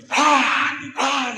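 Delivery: high whack sound effects; golpes imitados. Acted. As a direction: mimic two sharp whacks with the voice. (sonido agudo de golpes)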